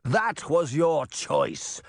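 indignant, emphatic cry. A narrator's reading. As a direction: throw it back, each word drawn out. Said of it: "Charming cried".

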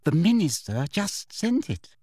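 high, sugary name-dropping; prim, falsely sweet, pleased with yourself; simpering. A narrator's reading. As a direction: simper with smug self-importance. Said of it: "simpered Umbridge".

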